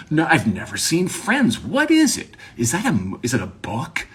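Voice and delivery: hammed-up bafflement, shooting up high; exaggerated cluelessness. Feigning ignorance of Friends to people, exaggerated cluelessness. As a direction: overplay never having heard of it. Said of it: "I'm not gonna exaggerate it like that".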